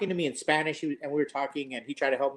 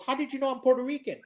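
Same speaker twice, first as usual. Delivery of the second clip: higher, quicker retold question; surprised question; 8 semitones higher, faster than usual. Puerto Rican man asks how he was found out, surprised. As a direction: imitate a man caught by surprise.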